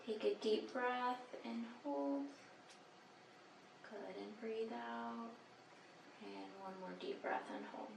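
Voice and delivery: soft, slow breathing instructions; quiet. Real, not acted. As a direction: hush it down while listening. Listening with stethoscope, calm instructions.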